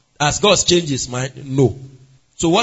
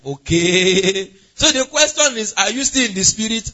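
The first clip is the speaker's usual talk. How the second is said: ordinary talk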